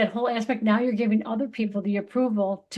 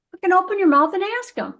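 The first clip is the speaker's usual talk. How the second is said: much higher, louder, fast burst of frustration; exasperated outburst; 9 semitones higher, 5 dB louder, faster than usual. Real, not acted. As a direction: blurt it out, fed up. Exasperated advice to listeners.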